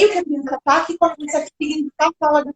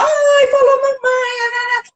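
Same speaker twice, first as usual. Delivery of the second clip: very high, drawn-out melodic coo; slow sing-song squeal of delight; delighted mommy; 11 semitones higher, much slower than usual. Acted. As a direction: celebrate a baby's first word in babytalk. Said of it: "essa melodia dá prazer pro bebê".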